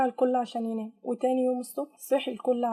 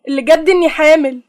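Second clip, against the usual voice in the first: loud, higher, agitated announcement; agitated outburst; 4 semitones higher, 14 dB louder than usual. Acted. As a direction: burst it out with angry nerves. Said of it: "قالت فريدة بعصبية". (بعصبية وصوت عالٍ)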